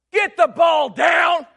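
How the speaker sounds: slow, loud, high coaching shout. Retold or imitated. Opposing coach shouting at his pitcher during timeout. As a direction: drill the instruction in.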